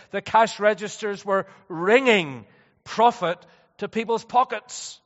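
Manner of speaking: loud, indignant pulpit storytelling; forceful preaching. Real, not acted. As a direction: proclaim it with emphatic force. Preaches with raised voice.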